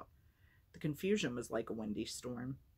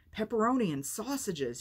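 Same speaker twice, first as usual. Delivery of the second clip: gleeful; 8 semitones higher, 6 dB louder than usual. Acted. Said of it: "trying not to smile".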